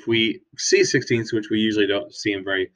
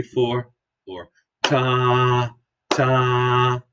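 held ta; 2 semitones higher, much slower than usual. Shown as a demonstration. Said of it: "we can count it".

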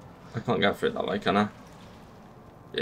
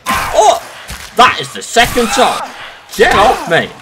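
high furious yell at the attacker; angry shout; 7 semitones higher, 6 dB louder than usual. Real, not acted. Gamer yells at an enemy hitting him, furious shout. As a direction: bark it out, fed up.

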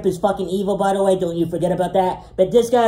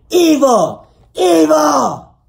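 hammed-up shout of evil, higher and louder; mock shout; 7 semitones higher, 8 dB louder, much slower than usual. Warning viewers off his rival, a hammed-up shout. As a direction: bellow evil twice in mock outrage.

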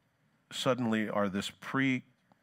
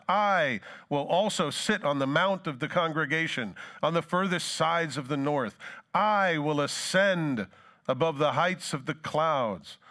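preaching scripture; 4 semitones higher than usual. Acted. Preacher reading Isaiah aloud, building and declaiming. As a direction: proclaim the verses to the congregation. Reads Isaiah passage with force.

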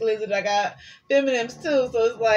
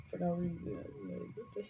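whispered aside; 13 dB quieter, faster than usual. Real, not acted.

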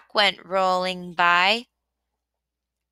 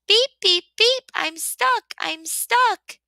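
desperate beeping; 10 semitones higher than usual. A narrator's reading. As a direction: honk for rescue, stuck tight. Stuck truck calls for help.

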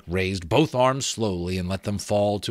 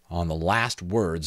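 usual speaking voice

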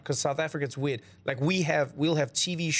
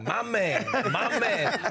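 cool Denzel; 6 semitones higher, much slower than usual. Retold or imitated. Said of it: "instead of being like".

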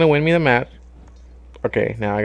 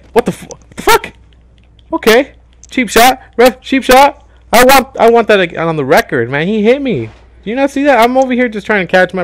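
much higher and louder, yelling at the ref; outraged protest; 9 semitones higher, 6 dB louder than usual. Real, not acted. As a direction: complain furiously to the referee about the cheap shot. Protests cheap shot to ref.

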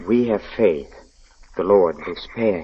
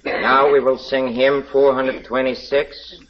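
preaching; 4 semitones higher than usual. Acted. Villain recites his sermon.

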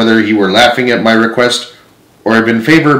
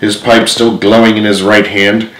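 usual speaking voice

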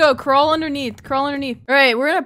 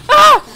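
high, louder fright scream; 12 semitones higher, 13 dB louder than usual. Real, not acted. Player surprised by a threat, screaming. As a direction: shriek in fright. Scared in horror game.

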